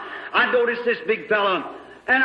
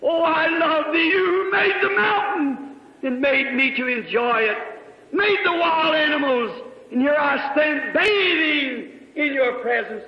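retold prayer; 5 semitones higher, slower than usual. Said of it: "I was standing with my hands up saying".

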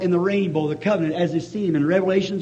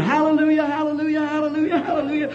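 screamed hallelujahs; 8 semitones higher than usual. Retold or imitated. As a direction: cry hallelujah out in joy on the mountain.

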